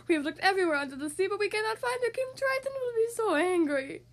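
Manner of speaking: fast, high, anxious crab voice; fretting Sebastian. A narrator's reading. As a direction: rush it like a panicked crab.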